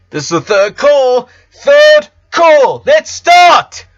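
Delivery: loud, high stage announcer calling out. Acted. Announcer calls the theatre to places, booming. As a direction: call it out to the whole theatre.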